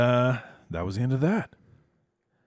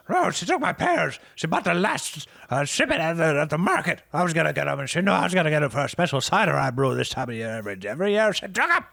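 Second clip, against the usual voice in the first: much higher, louder indignant rant; paranoid Herb; 8 semitones higher, 5 dB louder, faster than usual. Acted. Herb telling the party about his rival, an indignant high rant. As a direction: huff through the grievance. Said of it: "He's like".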